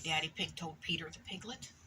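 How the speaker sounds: usual speaking voice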